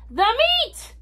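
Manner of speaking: high-pitched echoing roar; crew roar. A narrator's reading. Hungry crew answers the captain, high mock roar. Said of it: "we all roared".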